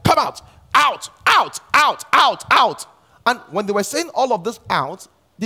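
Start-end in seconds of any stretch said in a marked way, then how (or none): 0.0-2.9 mock exorcism shout, louder and higher; shouting at a demon. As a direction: yell come out, out, like the disciples commanding. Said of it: "and they would say".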